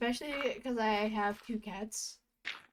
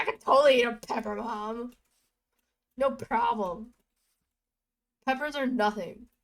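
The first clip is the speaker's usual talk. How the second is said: fast, loud cocky brag; mock tough kid boasting to mom; macho kid bragging; 2 semitones higher, 8 dB louder than usual. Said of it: "I was like".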